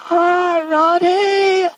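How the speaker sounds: high, loud crying wail of the name, imitating a devotee in tears; retelling a guru's ecstatic wail of Radhe, mock crying wail. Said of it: "He was literally wailing".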